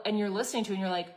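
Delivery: ordinary talk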